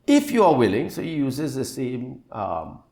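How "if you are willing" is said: higher, louder plea; pleading. Acted. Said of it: "He's pleading, he's begging".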